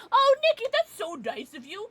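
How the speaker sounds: high, loud delighted thanks; gushing thanks. Real, not acted. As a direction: gush over a kind viewer. Touched reply to viewer.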